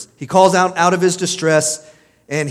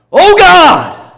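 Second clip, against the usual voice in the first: piercing full-voiced cry, much higher and louder; yelled at God; 12 semitones higher, 12 dB louder than usual. Preacher acting Jonah yelling at God, full-throated cry. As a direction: bellow it up to heaven. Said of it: "like, yelled at God".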